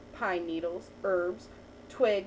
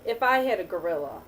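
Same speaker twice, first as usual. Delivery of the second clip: put-on reading to a child; 3 semitones lower than usual. Teacher reading a picture book to a child, lively storytelling. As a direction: read the picture book to the child, lifting each new animal.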